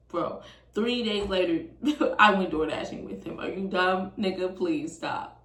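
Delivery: ordinary delivery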